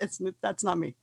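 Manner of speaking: ordinary talk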